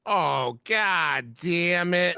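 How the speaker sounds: long hammed-up groan of defeat; mock frustration. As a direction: stretch out the regret word by word. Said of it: "And he goes".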